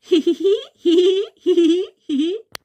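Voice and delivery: uproarious laughter. Acted. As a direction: laugh your throat out. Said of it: "हंस रही हो ऐसे गले फाड़ फाड़ कर". (गला फाड़कर हँसना)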